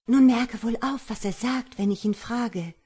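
woman calling over. A narrator's reading. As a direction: call out a pointed warning to a hidden ally. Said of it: "Da rief die schöne Frau dem Jüngling zu". (Frau, eindringlich zurufend)

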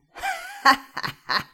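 high, loud cackling wizard laugh; mocking laugh. Wizard laughing at the ugly princess, cackling and mocking. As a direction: laugh her to scorn. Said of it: "lachte sich kaputt und verspottete".

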